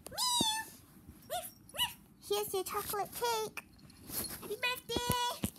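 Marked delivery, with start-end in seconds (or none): 0.1-0.7 high pretend meow; cat meow. Pretend pet greets birthday boy. 1.3-1.9 dog bark. Pretend. Pretend pet greets birthday boy.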